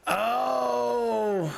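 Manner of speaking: high gasping oh of realization; shocked gasp. Real, not acted. Streamer finding the spell made her bleed, high shocked gasp. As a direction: gasp as it suddenly clicks. Discovers cause of own death.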